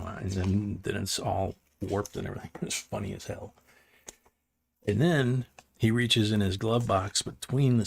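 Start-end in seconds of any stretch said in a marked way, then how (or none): none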